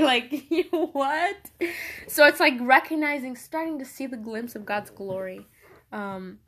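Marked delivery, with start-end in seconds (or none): 0.0-1.5 laughing disbelief. Retold or imitated. Mock disbelief at being blessed.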